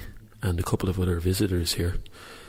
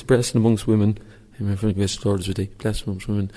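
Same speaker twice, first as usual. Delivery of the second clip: reciting a prayer aloud; 4 dB louder than usual. Shown as a demonstration. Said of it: "this is for you, Count Dracula".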